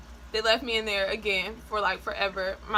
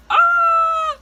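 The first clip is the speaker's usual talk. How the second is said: sudden high shriek, much louder; panicked scream; 12 dB louder than usual. Retold or imitated. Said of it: "I was like".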